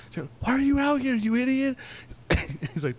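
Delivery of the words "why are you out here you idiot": high-pitched imitated telling-off; mock scolding. Mimicking Lori finding Val out of bed, high scolding. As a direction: scold like an exasperated partner, pitched way up. Voicing woman scolding partner at dawn.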